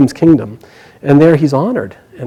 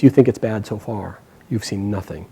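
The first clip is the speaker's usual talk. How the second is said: low, hushed foreboding that sinks away; grim warning; 5 semitones lower, 9 dB quieter than usual. A narrator's reading. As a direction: warn them softly and low of worse to come. Vyasa's grim warning of worse.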